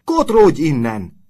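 high, very loud, slow command; furious shout. A narrator's reading. Nurse throwing the boy out, shouting loudly. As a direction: bellow it, stretching the words. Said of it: "kiáltott az ápolónő".

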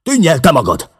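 high, loud furious shout; angry yell. A narrator's reading. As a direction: throw it back in his face. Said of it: "kiáltotta".

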